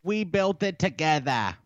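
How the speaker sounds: high-pitched mock Boston, dropped r's; exaggerated Boston "togethah"; Boston accent. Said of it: "again in a Boston accent".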